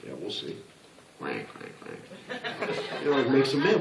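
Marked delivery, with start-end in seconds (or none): 0.1-0.6 dismissive. Retold or imitated. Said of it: "I go". 1.2-2.7 mock quacking. Mocking a boastful coachee.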